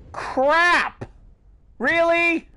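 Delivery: high, loud yelp of dismay. Real, not acted. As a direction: react in a high-pitched, exasperated squeak. Sudden setback in the game.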